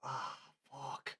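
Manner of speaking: quiet gutted sigh of a swear; resigned ah fuck. Retold or imitated. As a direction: let it sink out of you, beaten. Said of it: "I'm more just like".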